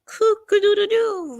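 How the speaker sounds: high, loud rooster crowing, drawn out; a full-throated cock-a-doodle-doo. Pretend. Reading comment, crowing like rooster.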